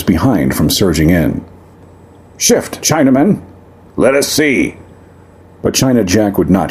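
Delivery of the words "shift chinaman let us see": high, pushy crowd yell; rough miner shouting. Acted. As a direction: shove your way in with words.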